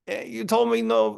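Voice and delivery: high, aggrieved whiny cobra voice; wounded cobra complaining. Acted. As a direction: whine it up high like a hurt snake accusing the guru.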